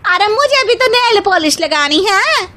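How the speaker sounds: high, loud, whiny chime-in; sassy young bride. Acted. Second daughter-in-law whines to mother-in-law, high and loud. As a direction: whine that you need it too.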